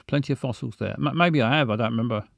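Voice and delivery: ordinary delivery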